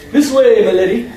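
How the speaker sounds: playful faux-English chauffeur; uppity English accent. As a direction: put on a snooty English accent. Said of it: "I intone in an uppity English accent".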